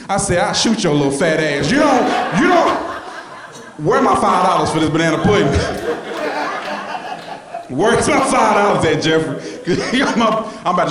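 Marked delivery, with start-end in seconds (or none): 0.4-9.4 mock furious threat. Dealer yelling at Jeffrey about the money, mock rage.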